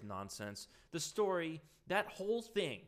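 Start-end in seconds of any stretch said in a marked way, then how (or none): none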